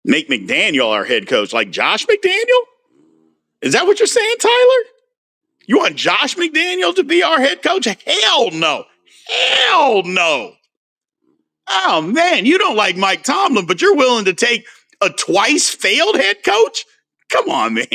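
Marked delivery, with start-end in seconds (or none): 1.5-7.8 can't believe what he's hearing, squeaking up high; incredulous. Real, not acted. Incredulous at a viewer's suggestion. 7.8-10.5 higher, rising into a shout; emphatic refusal. Real, not acted. Rejecting the coach idea outright. 11.7-17.8 exasperated. Real, not acted. Incredulous rant at viewer.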